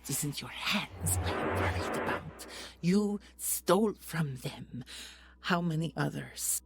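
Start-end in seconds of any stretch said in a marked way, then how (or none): 2.8-6.6 angry accusation. Acted. Hero accusing villain.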